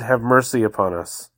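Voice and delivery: ordinary talk, nothing marked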